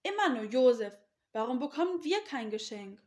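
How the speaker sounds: loud, indignant complaint; jealous brothers scolding. A narrator's reading. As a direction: grumble loudly in jealous protest. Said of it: "Sie schimpften".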